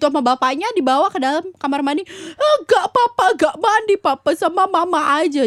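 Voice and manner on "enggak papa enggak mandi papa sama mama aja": high, loud mock toddler wail; imitated protesting child. Child carried to bath by papa, loud whiny protest. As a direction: howl like a kid hauled to the bath. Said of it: "dia teriak-teriak".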